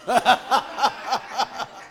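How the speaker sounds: big rolling laugh. Real, not acted. Preacher cracking up at his own nose joke, laughing. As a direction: burst into a long laugh.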